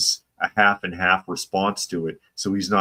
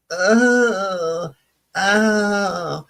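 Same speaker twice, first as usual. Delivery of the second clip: high drawn-out whiny "oh"; long, much higher put-on moan; Pat impression; 12 semitones higher, 4 dB louder than usual. Host imitating the SNL character, high moan. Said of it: "You might remember Pat from".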